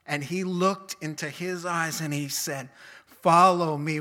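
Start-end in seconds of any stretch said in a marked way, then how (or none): 3.1-4.0 loud, forceful call; passionate command. Retold or imitated. Jesus calling Matthew to follow, loud and passionate. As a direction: call it out with full power. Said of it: "with the same being and passion".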